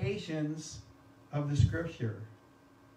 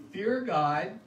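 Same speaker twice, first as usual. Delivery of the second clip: louder, proclaiming Fear God; loud angel's call; 2 semitones higher, 5 dB louder than usual. Acted. Angel proclaiming to the earth, a loud ringing call. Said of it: "And he said with a loud voice".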